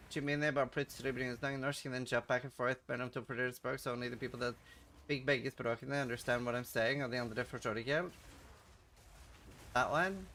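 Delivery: rapid jumping between Norwegian and English; Norwegian-English mix. Shown as a demonstration. Streamer confusing chat on request, Norwegian-English mix. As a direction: switch back and forth into Norwegian. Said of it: "Can you do the Norwegian and English mix thing".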